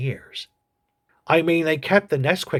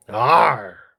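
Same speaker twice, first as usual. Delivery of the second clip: loud creature grunt of Arr; 2 semitones lower, 5 dB louder than usual. Acted. Agnew the creature replies to host with loud Arr. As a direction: Agnew grunts his point. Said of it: "I know".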